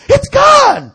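very high, loud squeal; hammed-up imitated excitement; astonished shriek. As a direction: squeal the news in disbelief. Imitating her amazed announcement.

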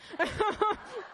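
short high giggle; quick amused laugh. Real, not acted. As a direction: laugh at the memory. Laughs recounting story.

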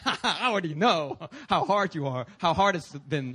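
scoffing laugh into the words; mocking question. Shown as a demonstration. God as he would not ask Doug, mocking. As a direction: sneer it as a know-it-all. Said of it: "like a mocking question".

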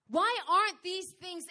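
high, loud retold plea; crying out. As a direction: cry it out as a desperate question. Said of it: "I remember crying out thinking".

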